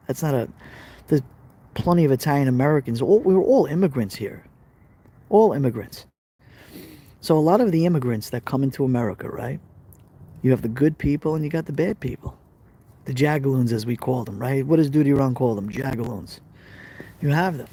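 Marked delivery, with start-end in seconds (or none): none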